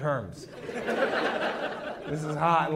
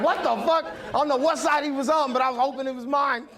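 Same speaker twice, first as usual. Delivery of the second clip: shocked; 10 semitones higher than usual. Retold or imitated. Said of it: "I'm like".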